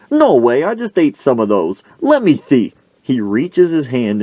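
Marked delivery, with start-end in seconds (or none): 0.1-2.7 high, louder mock disbelief; disbelieving. Coach told the onions stink, acted disbelief. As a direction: protest in a pitched-up coach voice.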